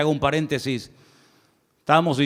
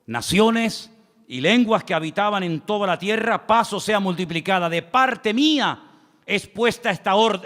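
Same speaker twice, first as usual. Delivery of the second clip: raised, slowed preacher's Bible reading; scripture reading; 6 semitones higher than usual. Acted. Preacher reading Daniel to the church, solemn and raised. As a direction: read the verse aloud to the congregation.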